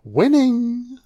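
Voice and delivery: high, drawn-out catchphrase; Charlie impression. Egging Charlie on to say his catchphrase, mock triumphant impression. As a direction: crow it high and stretch it out. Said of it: "Go on, Charlie. Just say".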